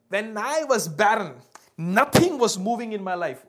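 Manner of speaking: loud, impassioned preaching as Hannah; getting excited. Acted. Mother telling Samuel of her barren years, loud and excited. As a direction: belt it out with mounting excitement. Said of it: "speaker is getting excited".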